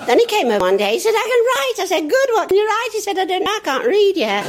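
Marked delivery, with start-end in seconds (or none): 1.2-1.8 put-on high, loud proud child; little boy. Child voice in retold story. 2.1-2.9 high, loud encouraging mum; mother's voice. Retold or imitated. Mother answering son. 3.2-4.4 put-on high, loud innocent child; little boy. Child voice in retold story.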